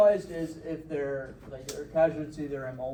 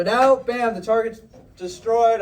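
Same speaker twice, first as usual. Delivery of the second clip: higher and louder, excited call; 7 semitones higher, 11 dB louder, slower than usual. Shown as a demonstration. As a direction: sell the hit like it just happened. Acting out the target hit.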